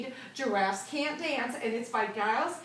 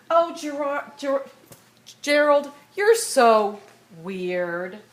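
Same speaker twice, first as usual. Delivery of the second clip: loud mocking jeer; taunting; 2 semitones higher, 8 dB louder, slower than usual. A narrator's reading. Animals taunting Gerald at the dance, loud mockery. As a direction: call out the insult to make fun of him.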